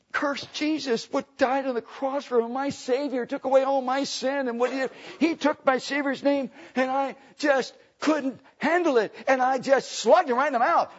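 high-pitched, impassioned retold confession; worked-up convert. Convert explaining the punch to the warden, high and worked up.

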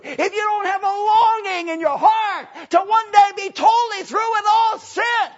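very high, loud sustained pulpit shout; full-force preaching. Real, not acted. Preacher proclaiming deliverance from sin to the congregation, at a full high shout. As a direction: shout it out, stretching the key words.

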